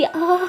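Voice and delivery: high "aah" of pain; acted ouch; mock pain cry. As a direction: yelp as if smacked on the back. Acted pain at being hit.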